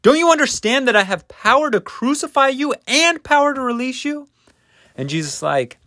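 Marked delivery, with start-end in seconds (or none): 0.0-4.3 rapid, very high-pitched demand; fast, loud, incredulous questioning; exasperated Pilate. Acted. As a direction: berate a silent prisoner, voice shooting up. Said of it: "And Pilate's like".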